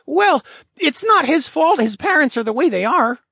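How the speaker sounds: put-on high, loud lynx boy; excusing a friend in a high kid's voice; high boyish voice with a shrug; Pratt. A narrator's reading. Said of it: "Pratt said with a shrug".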